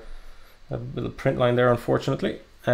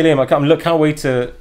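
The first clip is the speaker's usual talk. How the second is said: ordinary talk, nothing marked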